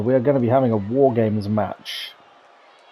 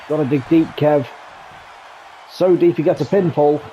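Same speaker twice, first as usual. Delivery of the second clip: rising, louder excited play-by-play; excited call; 4 semitones higher, 3 dB louder than usual. Real, not acted. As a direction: call it as the tension builds. Live call of near pinfall.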